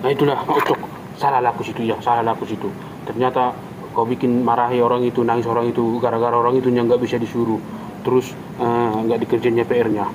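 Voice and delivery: husband humbly apologising to his wife; sheepish apology. Acted. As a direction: back down and admit you were wrong. Husband admits fault to wife.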